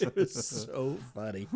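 loud, drawn-out words through laughter; real laughter, stretching the words; laughing while talking. Real, not acted. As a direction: barely get it out for laughing. Cracks up recalling funny episode.

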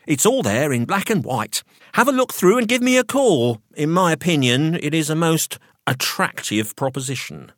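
high-pitched, officious solicitor voice; professional solicitor. A narrator's reading. Solicitor explaining the will, officious professional mode. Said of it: "switched back into professional mode".

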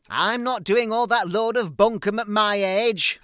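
very high, loud old-mother impression; shrill-free screech of an old mum complaining; loud hammed-up old mother voice; mother moaning. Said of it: "she moaned on".

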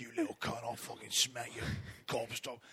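hammed-up Cockney threat, dropping in pitch; mock Cockney dad. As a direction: play a scolding Cockney father for laughs. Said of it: "like Cockney as fuck".

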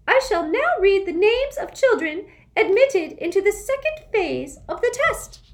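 high, loud prim official voice; a strict, shrill-free schoolmistress; stern test administrator. A narrator's reading.

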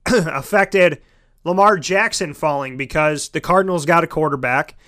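ordinary delivery

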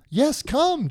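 high-pitched beckoning call; Jesus calling. Retold or imitated. Jesus inviting Peter onto the water, high call. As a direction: call him over from out on the water. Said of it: "Jesus said".

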